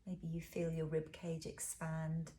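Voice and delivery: ordinary talk, nothing marked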